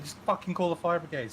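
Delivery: high, fast exasperated command; exasperated order. Retold or imitated. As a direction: snap the order at the wife. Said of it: "I said".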